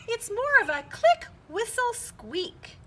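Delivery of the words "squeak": ordinary delivery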